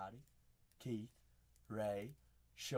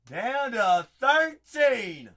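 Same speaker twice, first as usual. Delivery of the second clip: loud, slow, higher hype announcement; slow loud hype call; drawn-out hype call; 7 semitones higher, 11 dB louder, much slower than usual. Real, not acted. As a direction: stretch it out like a ring announcer. Repeats, hyped announcement.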